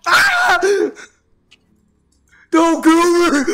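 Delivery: high frantic yell; panicked shout. Real, not acted. Player screaming as he gets killed, high panicked yells. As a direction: scream as the mob kills you. Dying in game.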